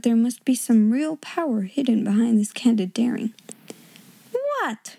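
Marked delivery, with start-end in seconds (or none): 4.3-5.0 very high, soft yelp of disbelief; shocked exclamation. A narrator's reading. Headmistress taken aback by the pupil, shocked exclamation. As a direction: pitch it way up, aghast. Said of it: "she exclaimed".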